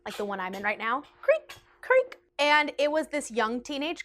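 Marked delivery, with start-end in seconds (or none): 1.2-2.2 high squeaky creak-creak imitation; chair creak. Showing viewers the chair, mimicking its high creak. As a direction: squeak out the sound of a rotating chair.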